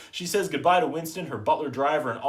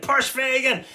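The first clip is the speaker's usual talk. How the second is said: higher, louder angry yell; mad yell; 11 semitones higher, 5 dB louder, slower than usual. Retold or imitated. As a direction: lose patience and bark it. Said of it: "the crime boss is getting all mad".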